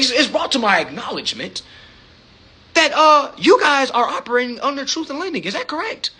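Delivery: nada tinggi dan resmi seperti pengusaha di telepon; seperti Bill Gates. Retold or imitated. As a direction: bicara formal ala bos besar. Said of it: "I be sounding like fucking Bill Gates".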